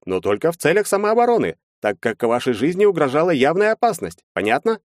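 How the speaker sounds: high-pitched, animated lawyer; lawyer character. A narrator's reading. Lawyer laying out the defense to his clients, high animated lawyer voice. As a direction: voice a lawyer reasoning in high, lively tones.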